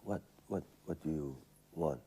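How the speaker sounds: low, quiet, grumbling boss; grumpy director. Retold or imitated. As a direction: brush off the question grumpily. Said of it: "a director".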